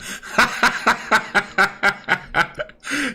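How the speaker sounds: long helpless high-pitched laugh; real burst of giggling laughter. Real, not acted.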